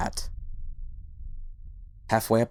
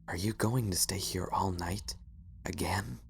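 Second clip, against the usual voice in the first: unvoiced, much softer tired whisper; 8 dB quieter than usual. A narrator's reading. Tired husband whispering to his watchful wife. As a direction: breathe it out wearily. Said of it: "tired sigh".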